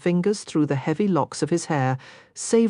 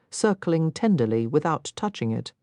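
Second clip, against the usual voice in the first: ordinary delivery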